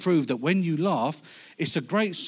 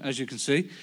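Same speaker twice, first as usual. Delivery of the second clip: ordinary talk, nothing marked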